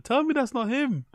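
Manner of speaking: high, squeaky quote; high-pitched mimicry. Re-quotes clip's line, pitched way up.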